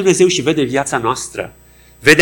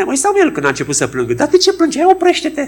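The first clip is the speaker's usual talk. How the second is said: much higher, sharp mock scolding; imagined rebuke; 11 semitones higher than usual. God scolding weeping Samuel, high mock rebuke. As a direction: voice a scolding God he does not mean.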